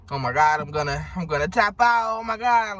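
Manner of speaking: loud, climbing fake freak-out; mock panic. Mocking people who dwell on death every day, mock panic. Mimics someone dreading death daily.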